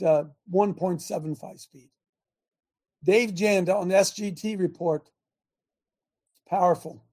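ordinary talk, nothing marked

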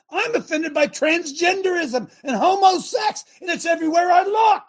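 loud, high, fired-up preaching; preacher to congregation, shouting his offence; indignant shout. Real, not acted. Preacher railing against offence.

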